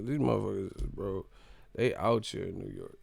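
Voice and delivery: normal talking voice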